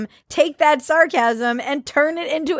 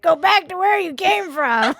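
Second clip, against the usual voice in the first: high, put-on angry-supporter jeer; mock MAGA supporter; 8 semitones higher than usual. Aping online MAGA supporters piling on a writer, high mocking voice. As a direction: imitate a heckling supporter, pitched well up. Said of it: "maga supporters who were like".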